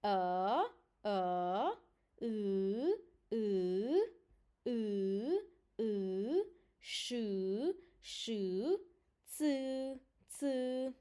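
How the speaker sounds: each sound held long and said twice with pauses; special-letter recap. Shown as a demonstration. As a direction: sound out "A, E, I, Sh, Ц" as isolated Romanian letters. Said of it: "recap again the special letters".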